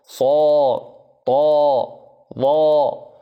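heavy emphatic sounds صاء طاء ظاء said full and thick; emphatic letters. Shown as a demonstration. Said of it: "نقول مثلًا خاء".

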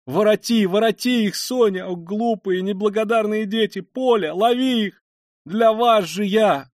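high, loud frantic cries; desperate calling. A narrator's reading. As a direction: cry after runaways in despair. Mother screams after fleeing children. (отчаянно кричать вслед)

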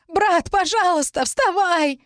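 put-on high, desperate girl's plea; pleading. A narrator's reading. Girl to her beaten brother, pleading. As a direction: beg him in a high, drawn voice. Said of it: "взмолилась девушка".

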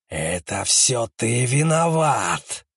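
slow accusing corpse voice; eldritch horror. Acted. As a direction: let the dead woman accuse, drawn out. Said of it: "раздался нечеловеческий голос".

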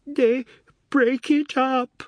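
high, loud, slow tearful cry; stifled sobs. Acted. Daughter telling father about the doll's house, tearful. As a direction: choke the words out through stifled sobs. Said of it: "She stifled her sobs".

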